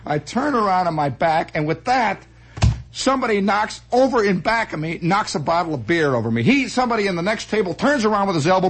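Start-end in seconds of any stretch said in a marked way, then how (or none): none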